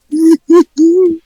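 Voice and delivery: loud burst of laughter. Real, not acted. Laughing loudly at his own joke. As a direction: crack up loudly.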